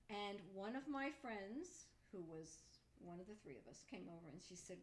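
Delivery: usual speaking voice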